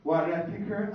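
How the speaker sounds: ordinary talk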